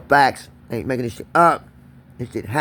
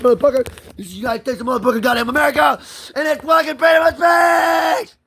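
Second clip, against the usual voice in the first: screaming at the top of his lungs, much higher; full-throated rant; 13 semitones higher, 6 dB louder than usual. Real, not acted. Ranting to the viewers about his right to free speech, all-out yelling. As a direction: yell it out, defiant and furious. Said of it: "I'm gonna rant about it".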